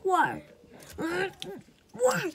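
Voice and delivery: high loud wailing whys; crying why. Acted. Letter grieving the lost letter, wailing why. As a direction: cry out in despair.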